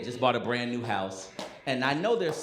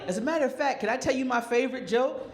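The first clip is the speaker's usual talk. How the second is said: put-on higher little-boy voice, lightly done; imitating a kid; 10 semitones higher, faster than usual. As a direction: voice an eager young boy. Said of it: "And he was like".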